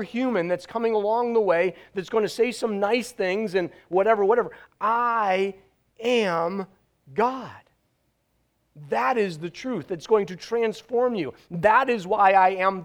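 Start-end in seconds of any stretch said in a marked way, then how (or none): 4.8-7.6 I, am, God with long pauses between; pause between words. Acted. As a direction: let each word land alone. Preacher voicing Jesus.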